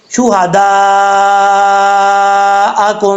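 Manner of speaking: شہداءکم with a heavy ha and the madd stretched long; drawn-out recitation of شہداءکم; slow tajweed demo. Shown as a demonstration. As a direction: stretch شہداءکم slowly, madd held five counts. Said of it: "پانچ الف کے برابر".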